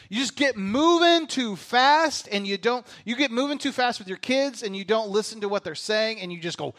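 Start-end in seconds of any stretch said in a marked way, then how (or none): none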